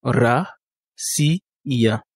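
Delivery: Ra, si, ya in three separate syllables. Shown as a demonstration. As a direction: say Ra, si, ya with a pause before each. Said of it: "donc trois syllabes".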